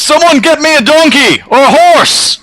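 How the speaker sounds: high, loud desperate yell for help; yelling at the top of his lungs. Pretend. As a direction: bellow it across the village. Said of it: "I yell at the top of my lungs".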